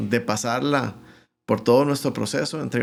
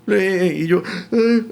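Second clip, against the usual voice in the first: high, hurting "eh"; acted wordless pain, pitched up; mock pained groaning; 7 semitones higher, 4 dB louder, slower than usual. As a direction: imitate being too sore to speak. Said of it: "no podía ni hablar del dolor".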